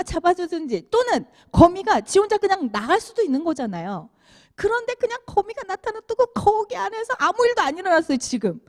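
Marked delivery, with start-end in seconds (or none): none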